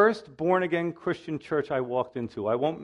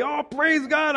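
joyful praise; 9 semitones higher, slower than usual. Retold or imitated. Father seeing son arrive.

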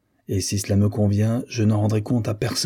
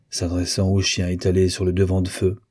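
usual speaking voice

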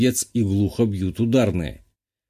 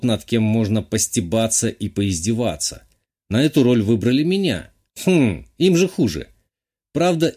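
ordinary delivery, nothing marked

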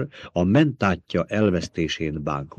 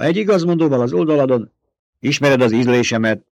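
fast, higher, louder snap; snapping; 5 semitones higher, 6 dB louder than usual. A narrator's reading. Baron snapping at his nephew, fast and loud. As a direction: bite the words off at him. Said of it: "förmet rá a báró".

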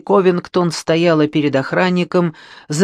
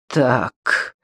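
sinister chuckle; 2 semitones lower than usual. Acted. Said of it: "зловеще усмехнулась Патси".

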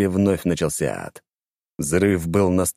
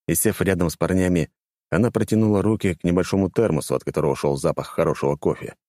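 plain reading voice

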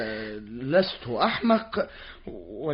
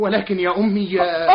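louder, tearful cry up high; wailing protest; 4 semitones higher, 9 dB louder than usual. Acted. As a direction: wail in distress. (صرخة احتجاج باكية)

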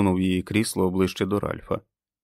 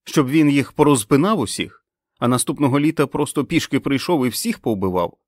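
high, raised voice of outrage; indignant outburst; 6 semitones higher, 6 dB louder than usual. Acted. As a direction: hurl the accusation as a question. Fran's outraged protest continues. (обурено, на підвищених тонах)

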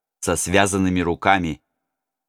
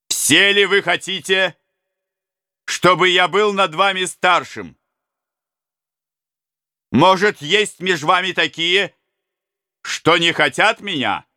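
challenging prince; 9 semitones higher than usual. Acted.

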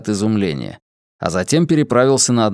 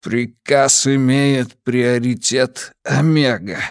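measured, solemn papal messenger; official courier voice; 2 semitones higher, slower than usual. Acted. Papal courier gives an order. (медленный официальный голос курьера)

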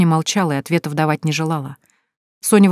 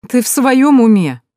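higher, louder, slow scoffing question; smirking; 7 semitones higher, 6 dB louder, slower than usual. A narrator's reading. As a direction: scoff at her. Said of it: "усмехнулся Вадим". (с усмешкой, выше и громче)

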